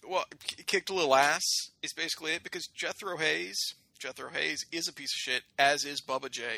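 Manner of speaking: usual speaking voice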